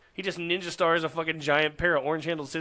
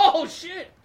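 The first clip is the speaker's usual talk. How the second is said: ordinary talk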